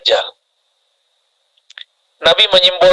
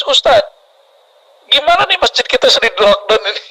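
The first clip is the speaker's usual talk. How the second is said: higher, mildly plaintive mock crying; imitated tearful plea; 5 semitones higher than usual. Congregant pleading to the ustadz over the mosque lockdown, mock tearful. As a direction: plead like an upset congregant, only lightly acted. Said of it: "nangis-nangis".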